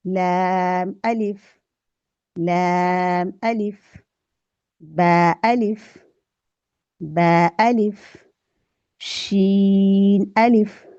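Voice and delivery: each अलिफ drawn out and falling; slow drill. Shown as a demonstration. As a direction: chant it slowly for students to repeat. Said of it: "चलिए मेरे साथ मिलके मश्क कीजिए". (धीरे धीरे मश्क, अलिफ खींचकर)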